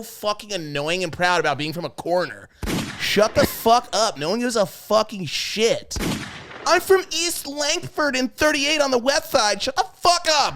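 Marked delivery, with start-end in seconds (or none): 3.0-10.6 angry rant. Real, not acted. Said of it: "ranting at New Yorkers".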